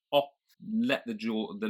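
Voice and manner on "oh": "oh" as a short round British O. Shown as a demonstration. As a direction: lips rounded, jaw dropped on "oh". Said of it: "make our lips round into a round shape".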